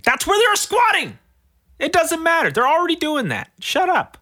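high, loud scornful rant; indignant rant. Real, not acted. Scoffing at a housing headline, loud and high. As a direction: shout down the headline.

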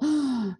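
a big gulp of air after running out; dramatic inhale; gasp for air. Shown as a demonstration. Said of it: "There's her breath".

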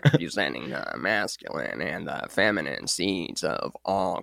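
low, soft, slow visionary voice; solemn mock spiritual sermon; Alex Grey impression. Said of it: "Can you do the Alex Gray again?".

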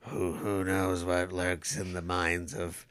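slow, ominous Shadow narrator voice; radio announcer voice. Retold or imitated. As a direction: intone it like the old radio Shadow. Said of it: "Scarlett Johansson is the Shadow".

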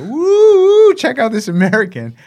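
high, loud hammed-up whoop; showing off. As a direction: play up a cocky show-off for the hidden cameras. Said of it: "I would just strip naked and just go".

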